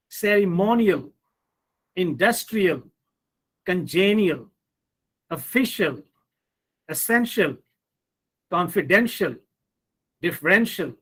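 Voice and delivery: cereMOnial, inDUStrial, conGEnial, ofFIcial, esSENtial, confiDENtial, differENtial; word drill, ceremonial to differential, each peaking before the ending; stress before the suffix. Shown as a demonstration. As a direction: punch the syllable before -ial from ceremonial through differential. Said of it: "the syllable that comes immediately before these suffixes".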